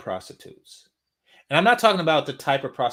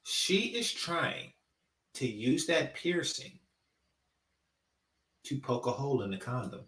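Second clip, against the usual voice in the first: hushed explaining; 5 semitones lower, 8 dB quieter than usual. Shown as a demonstration. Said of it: "I'm gonna say this again".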